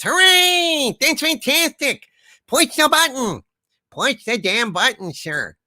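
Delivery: high, hammed-up cheering; mock cheer. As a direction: cheer it up like a fake party host.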